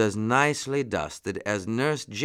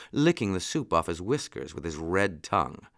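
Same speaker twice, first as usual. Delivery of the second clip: usual speaking voice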